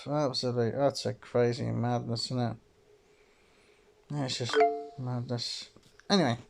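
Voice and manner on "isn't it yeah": ordinary delivery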